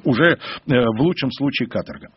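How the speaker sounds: normal talking voice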